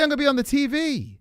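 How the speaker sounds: high, loud emphatic; correct version. Shown as a demonstration. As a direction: hit it like the obvious answer. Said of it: "You say".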